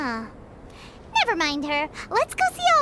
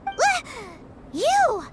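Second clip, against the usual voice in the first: startled gasp; 5 semitones higher than usual. Acted. Paimon startled by Rosaria's return.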